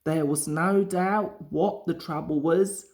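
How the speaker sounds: usual speaking voice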